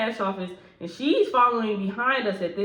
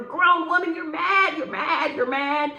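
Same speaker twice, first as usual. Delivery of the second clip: higher, louder sing-song jeer; taunting; 8 semitones higher, 4 dB louder, slower than usual. Real, not acted. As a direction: needle her with a high taunt. Taunting mad mad.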